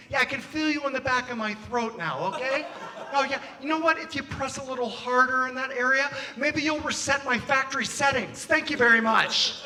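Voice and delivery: politely dance around the complaint; imitating an awkwardly courteous man; Jason Bateman. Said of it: "I end up sounding like Jason Bateman from Ozark".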